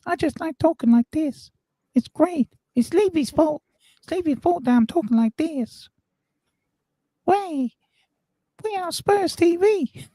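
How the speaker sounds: very high helium squeak; Kermit the Frog. Pretend. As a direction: talk as if you've sucked on a helium balloon. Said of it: "hitting the helium balloons again".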